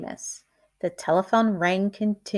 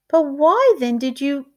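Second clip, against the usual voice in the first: higher, louder indignant ask; indignant question; 6 semitones higher, 3 dB louder than usual. A narrator's reading. Mrs Prim confronts Valente, indignant. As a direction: demand it, outraged. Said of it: "asked Missus Prim".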